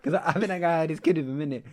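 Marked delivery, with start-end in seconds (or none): none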